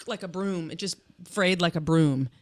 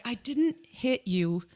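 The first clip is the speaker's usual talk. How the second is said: mock innocent; 3 semitones higher, much slower than usual. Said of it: "she's like".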